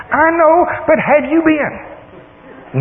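very high, insistent comeback; excited daughter. Retold or imitated. Daughter presses dad on the donation page, excited and high. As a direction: imitate an impatient, excited daughter. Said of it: "She's all excited".

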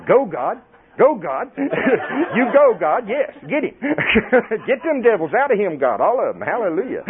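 high, excited egging-on with laughter; mock cheering. Imitating Susan urging God on, gleeful cheering. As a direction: cheer like a delighted supporter.

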